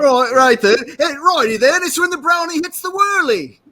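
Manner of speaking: a loud cheery Brit on request; English accent. Shown as a demonstration. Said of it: "Do that one more time with your accent".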